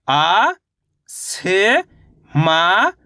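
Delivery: "आ", "से", "मा" one by one, each rising; अलग-अलग अक्षर. Shown as a demonstration. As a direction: sound out "आ", "से", "मा" with long gaps. Teacher sounds out letters separately. ("आ", "से", "मा" रुक-रुककर, चढ़ते सुर में)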